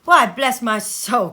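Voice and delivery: loud, slow, laughing exclamation; startled cry. Acted. Fred startled by Scrooge at the door, laughing loudly. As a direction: burst out with a laugh of surprise. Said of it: "cried Fred".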